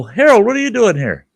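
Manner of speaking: high, loud surprised call across the room; surprised kid. Retold or imitated. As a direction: call out to a buddy you didn't expect. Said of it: "And I go".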